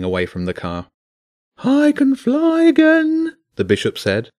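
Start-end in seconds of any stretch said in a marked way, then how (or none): 1.6-3.4 high, loud and slow bird voice; a pigeon drifting happily, high and slow; high bird voice, drawn out; dreamy pigeon. Acted.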